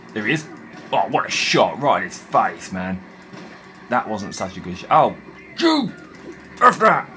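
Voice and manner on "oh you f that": sudden high yell at the enemy; angry shout. Real, not acted. Gamer jumped by a monster, yelling at it. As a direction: blurt it out loud and high, startled. Startled by enemy in dark game.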